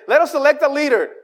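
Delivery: a grumbler calling out, high and loud; mock Israelites. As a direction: rouse the crowd to pick a leader. Israelites voiced plotting to return.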